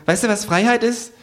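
hohe Figurenstimme, fragend; hoch und verschwörerisch fragen; Känguru. Acted. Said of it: "sagt das Känguru". (hohe Känguru-Frage)

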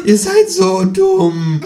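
high, drawn-out kangaroo squawk; croaking kangaroo. A narrator's reading. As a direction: squawk it slowly, loud and exasperated. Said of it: "krächzt das Känguru".

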